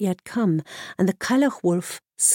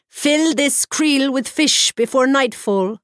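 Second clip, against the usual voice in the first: put-on slow, loud, high old hag's order; a loud, slow crone's command; 6 semitones higher, 5 dB louder than usual. A narrator's reading. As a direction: give the order like a stern old witch. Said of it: "commanded the Cailleach".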